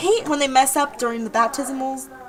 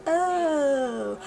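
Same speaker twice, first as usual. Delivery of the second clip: long, trailing awkward "oh"; 3 semitones higher than usual. Retold or imitated. Stuck in an awkward phone conversation, awkward drawn-out oh. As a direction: stretch it out with nothing to say. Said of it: "awkward conversations where you don't know what to say".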